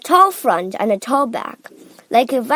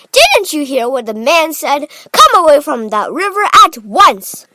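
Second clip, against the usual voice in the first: loud, raised scolding call; Missus Gloop; 5 semitones higher, 9 dB louder than usual. A narrator's reading. Mother ordering her son from the river, calling out. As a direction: order the child back, loudly. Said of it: "called out Missus Gloop".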